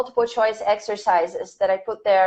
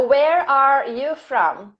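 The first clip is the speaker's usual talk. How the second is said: slow, measured dictation; unhurried teacher reading out a question; 2 semitones higher, slower than usual. Shown as a demonstration. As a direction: stretch each word out for students writing it down. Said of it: "This is dictation".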